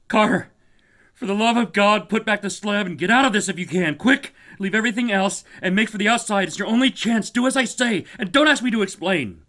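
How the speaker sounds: very high, loud, frantic pleading; wild consternation. Acted. Warren urging Carter to flee, wild panic. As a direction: scream the warning in panic. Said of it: "in a pitch of wilder consternation".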